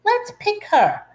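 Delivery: high sing-song tease; taunting classmate, pitched high; mocking child. A narrator's reading. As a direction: squeal it like a cruel little girl. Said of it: "said Rita".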